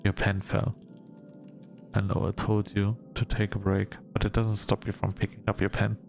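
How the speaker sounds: usual speaking voice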